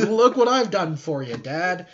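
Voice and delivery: hammed-up boy seeking praise; eager son voice.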